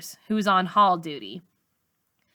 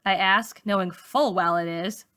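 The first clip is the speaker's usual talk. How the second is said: usual speaking voice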